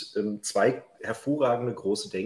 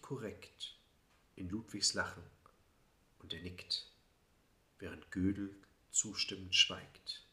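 poem recitation; 3 semitones lower, 6 dB quieter than usual. Acted. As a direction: recite it like a quiet lyric poem. Poem read aloud.

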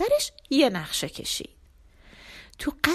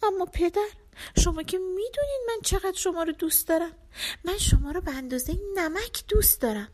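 higher, fast pleading, a touch of tears; crying; 8 semitones higher, faster than usual. Acted. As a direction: beg her father, half in tears. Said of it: "دختر کوچیک گریه کرد و گفت".

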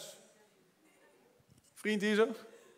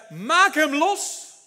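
drawn-out, much slower, higher and louder; slow command; 6 semitones higher, 7 dB louder, much slower than usual. Acted. Jesus ordering the people to unbind Lazarus, slow command. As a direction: lay the order down word by word.

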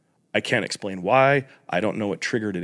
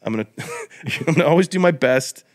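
mock vow; 5 semitones higher than usual. Imitating the first dude keeping his word, high and proud. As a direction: proclaim it like a hammed-up sage. Acting the first enlightened man.

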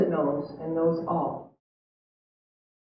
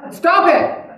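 high, loud mock-stern outburst; explosive punchline yell; sudden comic shout; 8 semitones higher, 5 dB louder than usual. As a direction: burst out with the psychiatrist's blunt order. Psychiatrist yells punchline again.

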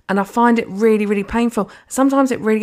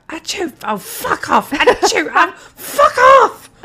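high mock sneezes and curses, growing louder; imitating angry coworker; 12 semitones higher, 7 dB louder, slower than usual. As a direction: act out her sneezing and swearing, building to a shout. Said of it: "she's like".

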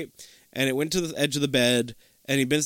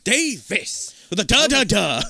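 loud, higher showman's correction; hammed-up name correction; 7 semitones higher, 5 dB louder than usual. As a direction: punch out each syllable indignantly. Imitating character correcting his name.

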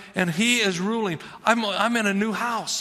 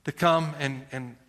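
prayer; 3 semitones lower than usual. Real, not acted. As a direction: pray gently over the room. Pastor prays aloud.

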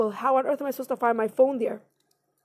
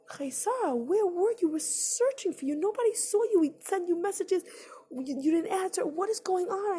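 higher, hushed, anxious questions; worried roommate; 6 semitones higher, 4 dB quieter than usual. Retold or imitated. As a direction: fret over a missing friend. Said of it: "my roommate's like".

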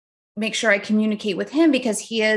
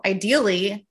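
normal talking voice

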